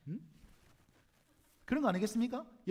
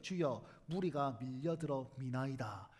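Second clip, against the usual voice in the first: lower, slow, stately scripture reading; dignified; 11 semitones lower, 4 dB quieter, slower than usual. Shown as a demonstration. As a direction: say 무리가 solemnly, low and slow. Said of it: "이렇게 점잖하게 얘기했을까요?".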